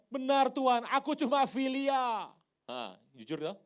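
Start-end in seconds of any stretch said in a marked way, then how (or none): 0.1-2.3 high, loud protest stretched at the end; loud confession. Acted. Peter answers Jesus honestly, loudly confessing. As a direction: cry out the admission, drawing it out.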